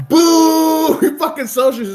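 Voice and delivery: high, loud mocking boo; crowd boo. Heckling crowd booing Bernie Sanders, loud high jeer. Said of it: "they're like".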